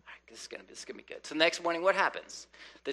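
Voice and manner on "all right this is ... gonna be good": soft, hushed mutter to himself; whispered aside. Retold or imitated. Gideon whispers to himself about the fleece test. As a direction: murmur it like a private bet.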